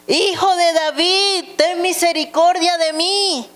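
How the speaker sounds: very high, insistent narrated plea; urgent calling voice within a scripture reading. A narrator's reading. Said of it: "pero él clamaba mucho más".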